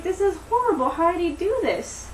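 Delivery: high-pitched, dismayed outcry; mock distress. Daisy split up from Albert by a boulder, mock distress.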